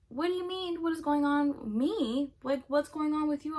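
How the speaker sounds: high-pitched, indignant disbelief; incredulous comeback. Retold or imitated. Confronting him on FaceTime, high and incredulous.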